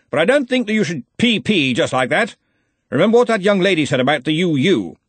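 head wizard laying down the law; blustering Arch-Chancellor. Acted. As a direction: chide your assistant with hearty authority. Ridcully chides Ponder.

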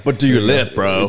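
gym bro voice. Pretend. Mocking gym bro talk.

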